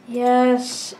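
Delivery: ordinary talk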